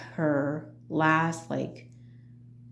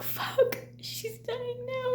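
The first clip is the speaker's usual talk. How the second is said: hushed, breaking despair; higher, crying whisper; choked whisper; 7 dB quieter, much slower than usual. Retold or imitated. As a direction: whisper it through tears.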